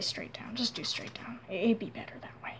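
whispered, half to herself; whispered instructions. Real, not acted. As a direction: talk it through under your breath. Quiet aside while drawing.

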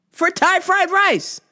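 high, slow, drawn-out complaint; exasperated. Real, not acted. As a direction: drag the words out in disbelief. Complaining about the wait.

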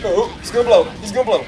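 alarmed warning. Real, not acted. Player seeing an enemy about to blow, alarmed warning. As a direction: call out a sudden danger.

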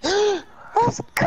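gasp, then a loud high cheer; excited shout. Real, not acted. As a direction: yell it out in triumph. Victory in the game.

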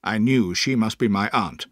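ordinary delivery

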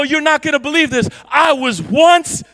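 loud, exultant proclaiming; joyful testimony. Acted. Preacher voicing a freed man, exultant shouting. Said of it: "walk around and go".

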